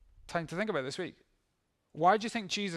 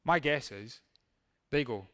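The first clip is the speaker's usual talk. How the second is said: normal talking voice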